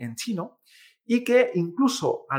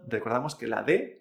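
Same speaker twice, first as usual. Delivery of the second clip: ordinary talk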